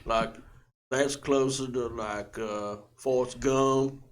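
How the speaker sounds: Southern, stretched out like Alabama; Southern accent. Shown as a demonstration. Performer asked for Alabama, Southern accent. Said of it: "do some Southern".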